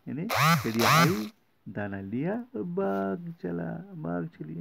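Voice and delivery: high excited storyteller voice; lively storytelling. Acted. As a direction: tell it with bright excitement. Storytelling bird flying off.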